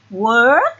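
loud, rising incredulous question; affronted, voice swooping up; appalled disbelief. A narrator's reading. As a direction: echo the word in horrified disbelief. Sisters appalled at having to work.

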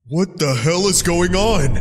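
high, loud, drawn-out bewilderment; alarmed outburst. Acted. Fighter baffled by what is going on, alarmed. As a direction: cry out in loud confusion. Startled outburst at sudden events.

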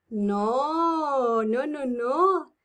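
long drawn-out "no", slow and singsong; slow, exaggerated teacherly no; playful refusal. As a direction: stretch the no out for little children. Teacher playfully answers her own question.

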